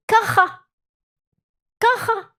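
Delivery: "Kacha" high and loud with a throaty ch; "Kacha" hard and throaty; Hebrew word. Shown as a demonstration. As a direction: say "Kacha" with a guttural kh. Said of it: "you have to say the word kacha".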